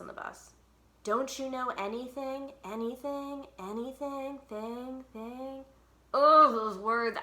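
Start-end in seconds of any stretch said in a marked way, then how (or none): 1.0-5.6 each repeat fainter, like an echo; echoing words. A narrator's reading. Words echoing around the bus, fading echo. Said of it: "as if they are echoing all around us". 6.1-7.2 regretful groan. Acted. Regret over words.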